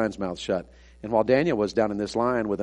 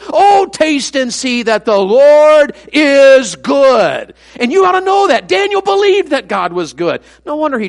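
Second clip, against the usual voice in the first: high, loud pulpit cry, slow at times; fiery revival preacher, raised and ringing; full-force preaching; 12 dB louder than usual. Acted. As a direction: preach it out to the back pew.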